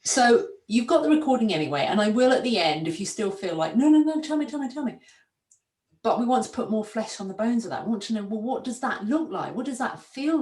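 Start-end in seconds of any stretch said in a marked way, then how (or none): 3.7-5.0 high, eager begging, fading off; mock pleading. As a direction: playfully beg to be told. Mimicking client begging for answers.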